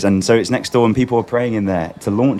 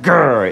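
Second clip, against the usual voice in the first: short playful growl, higher and louder; fighting growl; 4 semitones higher, 3 dB louder than usual. Retold or imitated. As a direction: growl like charging into battle. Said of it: "you kind of do it and you're like".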